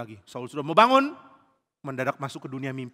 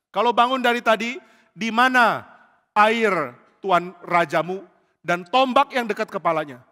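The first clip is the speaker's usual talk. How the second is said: raised, high calling voice; loud, stretched words across a distance; loud challenge; 6 semitones higher, 8 dB louder than usual. Acted. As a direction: call out a taunting question. Daud menantang Abner dari jauh.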